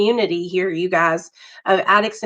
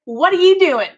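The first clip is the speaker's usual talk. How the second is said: laughing through the question; 9 semitones higher than usual. Retold or imitated. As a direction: ask it while breaking into laughter. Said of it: "I was like".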